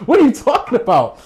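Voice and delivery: can't believe it, laugh it out; telling the girl he got nothing yet, laughing; laughing disbelief. Retold or imitated. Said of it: "I'm like".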